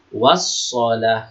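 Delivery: slow "Wassalah", doubled s with no l before it; silent alif lam. Shown as a demonstration. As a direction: recite "Wassalah", holding ss and the long vowel. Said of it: "Itu namanya alif lam syamsiah".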